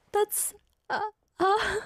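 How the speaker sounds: getting teary and stuck for words; really tearing up, barely getting words out; real choked-up crying. Real, not acted. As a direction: voice catching on the verge of tears. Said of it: "I'm tearing up right now".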